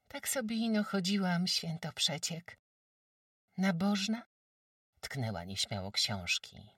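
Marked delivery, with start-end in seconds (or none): 0.1-4.2 put-on high, bright village girl; high girl's voice. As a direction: play a shy, admiring peasant girl. Jagusia again.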